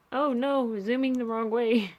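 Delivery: sudden little panic; panicked. Real, not acted. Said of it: "Okay, right, I panicked".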